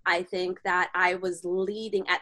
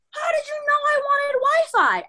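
very high, squealing excitement; hammed-up squeal of amazed delight; over-the-top elation; 3 dB louder than usual. Retold elation at finding Wi-Fi.

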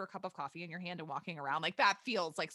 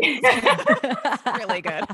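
laughing it out slowly and louder; 4 semitones higher, 15 dB louder, much slower than usual. Real, not acted. To the listeners about her podcast, laughing. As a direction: giggle through the plug.

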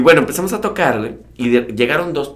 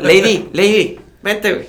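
high, loud teasing call; calling out to Lady; 8 semitones higher, 3 dB louder, slower than usual. Retold or imitated. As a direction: hollers for her across the festival. Jokingly calls out to [name].

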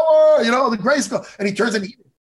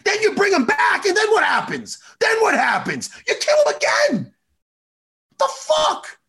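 angry rant; 9 semitones higher than usual. Real, not acted. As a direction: build into a yell of disbelief. Ranting about killing He-Man.

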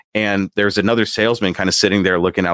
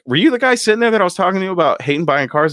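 fast, high, disbelieving question; incredulous; 11 semitones higher, faster than usual. Retold or imitated. Chris asking the floor manager in disbelief, fast and high.

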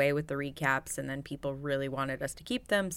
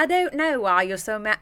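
louder, higher attempt at a British accent; 5 semitones higher, 7 dB louder than usual. Failed practice for fighting a partner, attempted British accent. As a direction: try a wobbly mock British accent. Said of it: "British accent".

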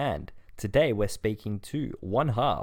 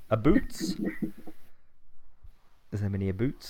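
ordinary delivery

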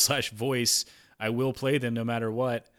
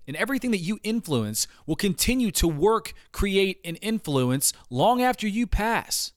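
high-pitched, intense guru monologue; dramatic motivational speech; 7 semitones higher, 4 dB louder than usual. Acted. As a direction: preach it like a spiritual guru. Dramatic podcast intro monologue.

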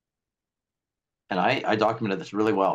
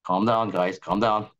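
lower, soothing lawyer voice, settling the room; calm attorney; 4 semitones lower than usual. Pretend. Pfizer attorney calming panicked Moderna staff, lower and soothing. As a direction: talk them down like a steady attorney. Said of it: "the attorney says".

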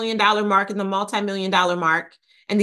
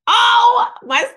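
a real thrilled "Oh" jumping way up and much louder; excited squeal; 7 dB louder than usual. Real, not acted. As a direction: squeal with delight. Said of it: "You can hear it in my voice".

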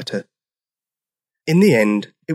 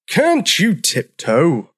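drawn-out, exasperated demand; slow, higher-pitched grumpy demand; 6 semitones higher, much slower than usual. A narrator's reading. Old Triceratops to heavy-footed newcomer, demanding. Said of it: "demanded George".

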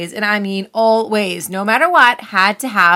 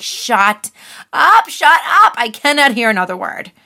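retold yell; 3 semitones higher, 4 dB louder than usual. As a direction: act out finally snapping at an overbearing friend. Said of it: "and I just yelled".

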